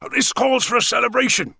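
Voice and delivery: a father ready to pop the champagne; jolly father. Acted. Father celebrating.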